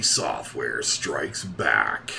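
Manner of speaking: slow, dramatic promo voice; hammed-up show teaser; mock TV promo announcer. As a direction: tease the next episode in slow announcer style.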